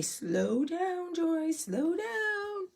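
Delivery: soft, very slow drawn-out slow down; gently mock yourself for talking fast; viewer telling her to slow down. Host voices a viewer asking her to slow down, soft and slow. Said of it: "just say".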